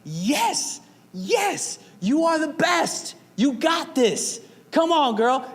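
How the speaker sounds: high, loud sideline cheer; yelling cheer. Retold or imitated. Dad at volleyball game cheers his daughter's serve, loud yell. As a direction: cheer your kid on at full voice. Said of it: "And I'm yelling".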